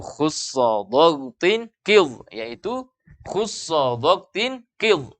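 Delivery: drawn-out Arabic syllables; slow mnemonic recitation. Shown as a demonstration. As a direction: recite it slowly so students can memorize it. Spelling out the mnemonic for students.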